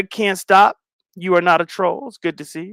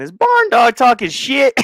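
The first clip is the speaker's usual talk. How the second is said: playful callout; 7 semitones higher, 5 dB louder than usual. Real, not acted. Calling out a chatter.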